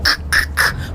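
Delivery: sharp voiceless throat noises, repeated; imitated cat attack. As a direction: mimic a cat striking with harsh clicks. Imitates cat attacking her toe.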